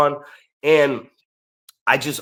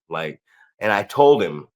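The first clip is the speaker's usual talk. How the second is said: normal talking voice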